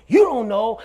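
imitated insistence. Possessed man confronts the officer stepping out, agitated insistence. Possessed man's rant retold.

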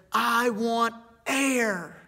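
breathless, high and loud, stretched slowly; desperate gasping plea for air. Retold or imitated. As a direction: gasp it out like a man just up from the water. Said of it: "he's gasping for air".

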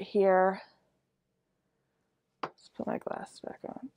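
muffled cloth-covered speech; talking behind fabric mask; through a face mask. Real, not acted. Seen 4: Cloth mask covers mouth and nose throughout the labelled words.

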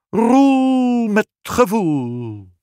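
high, very slow, drawn-out magical pronouncement; fairy spell. Acted. Aunt Oena grants her wish over the cradle, high slow spell voice. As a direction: cast the name like a spell, slowly.

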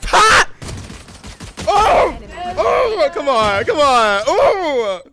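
loud, high cheering whoops; screaming hype for a clutch; hype shouting. Real, not acted. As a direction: scream the teammate on. Cheering a teammate's clutch shot.

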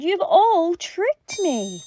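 high, drawn-out wail; howling. A narrator's reading. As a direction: wail it slowly, betrayed. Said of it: "he howled".

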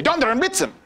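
playful cry of surprise; mock shocked exclamation. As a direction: blurt it out like a startled German. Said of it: "you know, shock".

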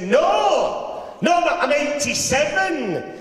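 put-on high, loud old man's protest, drawn out; a proud old boy putting him right; indignant old granddad. Granddad correcting the butcher with a high, loud insistence.

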